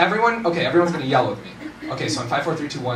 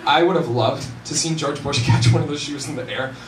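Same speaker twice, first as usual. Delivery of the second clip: ordinary talk, nothing marked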